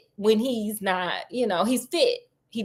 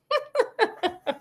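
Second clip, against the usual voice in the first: a sudden high burst of laughter; 12 semitones higher, 4 dB quieter than usual. Real, not acted. Narrator cracking up at the joke, high laugh.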